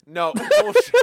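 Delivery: high laughing protest. Real, not acted. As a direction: call it out mid-laugh. Laughing disbelief.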